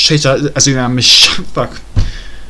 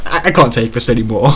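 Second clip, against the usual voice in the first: usual speaking voice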